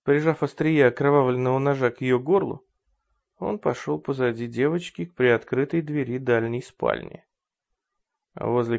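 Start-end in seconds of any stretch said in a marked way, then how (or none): none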